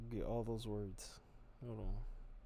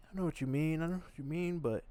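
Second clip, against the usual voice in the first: higher and louder, insistent fatherly warning; dad scolding; 7 semitones higher, 6 dB louder than usual. Retold or imitated. Imitating his dad cutting off his excuses, higher and louder. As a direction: brush off the excuse, then hit the warning hard. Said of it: "He'd be like".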